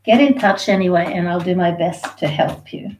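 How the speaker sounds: ordinary delivery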